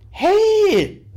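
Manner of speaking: loud, high, startled shout; perplexed call. A narrator's reading. Rainer as she sprints past into the bathroom, perplexed shout. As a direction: call out sharply, taken aback. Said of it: "rief er perplex".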